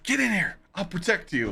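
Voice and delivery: lightly hammed-up, high-pitched urgent call; mock frantic guard. Guard calling strangers to shelter, mock urgent voice. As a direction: imitate an overeager protector.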